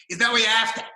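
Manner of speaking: loud, high Aussie check; broad Australian accent. Retold or imitated. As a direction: ask it like a bogan waiter. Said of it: "he spoke with a very broad Australian accent".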